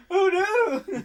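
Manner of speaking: high, loud fake gasp of horror; mock shock. Laughing at his own spoiler, mock horrified. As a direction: squeal "oh no" like the spoiler ruined it.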